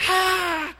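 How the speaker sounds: high, loud mock baby wail; baby still crying. Preacher imitating the baby who keeps screaming. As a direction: cry out again like the hungry newborn. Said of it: "Y sigue gritando".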